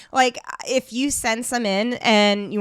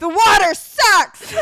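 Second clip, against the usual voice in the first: slow, loud joking complaint; mock shout; 9 semitones higher, 6 dB louder, slower than usual. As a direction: shout it for a laugh. Joking shout at field day.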